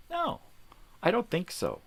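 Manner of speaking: high, emphatic no; emphatic refusal. Acted. Refusing to yield to pushy drivers, defiant. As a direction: shut it down hard.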